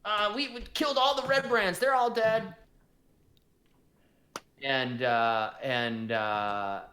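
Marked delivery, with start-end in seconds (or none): 0.1-2.6 high, excited little goblin voice; goblin Droop. Acted. Goblin boasting to the innkeeper, high excited voice. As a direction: squeak it out proudly like a small goblin. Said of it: "Droop says".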